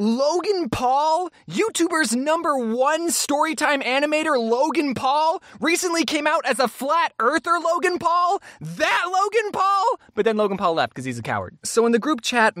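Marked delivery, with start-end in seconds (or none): 0.0-10.0 high, incredulous questioning that keeps climbing; hammed-up shock, stretching the name out; mock disbelief. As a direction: exclaim it higher and higher in comic disbelief. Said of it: "my mind went".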